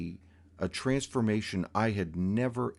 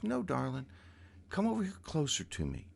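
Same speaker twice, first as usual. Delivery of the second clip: higher, lilting coaxing voice; sweet lilting voice; 8 semitones higher, 6 dB quieter, faster than usual. Retold or imitated. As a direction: coax sweetly, sing-song. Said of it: "this beautiful, lilting voice".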